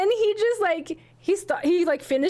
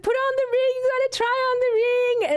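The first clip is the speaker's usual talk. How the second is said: much higher, eager, pushing him to try the ring on; excited urging; 11 semitones higher than usual. Retold or imitated. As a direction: squeal it out like you can't wait. Urging fiancé, excited retelling.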